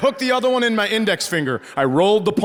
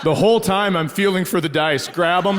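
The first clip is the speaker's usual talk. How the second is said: ordinary talk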